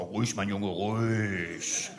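low, soft, slow reassurance; mock calm. Comedian calms the stuttering bomb man, low and soft.

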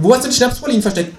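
high, fast teasing kangaroo; quick high tease. Acted. Kangaroo teases narrator about wordplay, high fast.

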